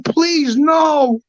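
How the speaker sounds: high pleading mock scream; retold screaming. Friend begging him not to leave, mock high scream. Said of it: "My friend was screaming".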